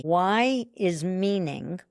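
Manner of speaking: ordinary talk, nothing marked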